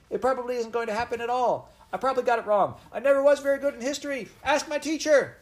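put-on very high, loud, desperate child; wretched cry. A narrator's reading. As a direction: plead frantically in a high-pitched voice. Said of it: "cried the wretched Eliza".

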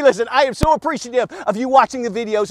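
ordinary talk

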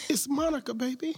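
very high falsetto announcement; mock diva insisting on who she is; imitating cajoling lady. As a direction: coax in a put-on high woman's voice. Retells lady claiming to be Monica.

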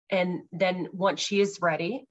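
usual speaking voice

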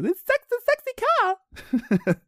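slow, very high falsetto; hammed-up mother voice; impression of mum. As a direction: draw it out in a squealing mum voice. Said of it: "pretty good impression of my mum".

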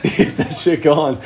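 laughter spilling into the words; laughing. Real, not acted. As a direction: keep talking while cracking up. Cracks up at own story.

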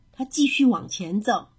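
ordinary delivery, nothing marked

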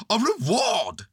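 very loud Owl. A narrator's reading. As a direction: insist loudly, pitched high. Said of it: "said Owl very loudly".

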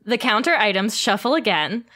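usual speaking voice

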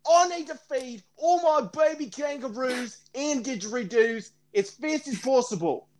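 high-pitched, hammed-up Australian accent. Joking plea to podcast listeners, exaggerated Australian accent. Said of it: "I'm gonna do an Australian accent".

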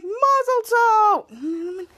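high, drawn-out jubilant cry; celebratory cheer. Retold or imitated. Man stomps the bag at mock wedding, celebratory cheer. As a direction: act out a festive toast, stretching it long.

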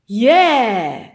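loud, whooping cheer that rises then falls; jubilant whoop. Acted. Skiing weatherman arrives before the camera, jubilant. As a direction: yell it out in triumph.

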